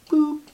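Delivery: very high short boop; comic boop. Acted. Preacher marks Pharaoh vanishing with a high boop. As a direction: pop it like a bubble vanishing. Sound of a life gone.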